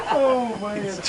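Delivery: shrieking with real laughter; loud high laughter. Real, not acted.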